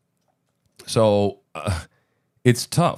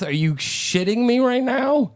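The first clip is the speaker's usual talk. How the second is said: incredulous. Real, not acted. Disbelief at the NATO song.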